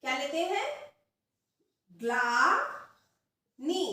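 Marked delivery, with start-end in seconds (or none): 1.9-3.8 ग्लानी drawn out slowly, ग्ला as one syllable; slow dictation. Shown as a demonstration. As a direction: stretch ग्लानी slowly, ग्ल joined. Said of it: "इसका उच्चारण भी ध्यान से देखेंगे". (धीरे धीरे ग्लानी, ग्ल जोड़कर)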